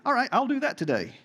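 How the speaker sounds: mock can-do agreement; mock eagerness. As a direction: play the naive volunteer, tongue in cheek. Mock response to an impossible example.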